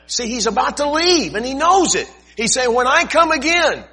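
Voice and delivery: loud, rising fervent scripture reading; preaching. Acted. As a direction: proclaim the verse from the pulpit. Preaching at full force.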